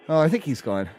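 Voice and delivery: ordinary talk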